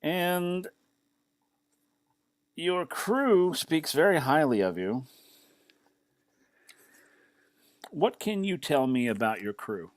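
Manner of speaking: measured official address with long pauses; deliberate military commander. Acted. Commander formally praises the captain of the Windrider, deliberate and measured. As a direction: open high and firm, then weigh each phrase.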